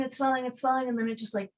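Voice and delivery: ordinary delivery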